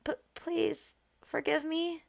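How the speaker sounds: scolded maid, hushed and hesitant; timid pleading. Acted. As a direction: beg forgiveness in a meek little voice. Timid maid begs forgiveness.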